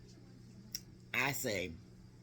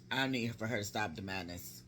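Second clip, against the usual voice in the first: ordinary delivery, nothing marked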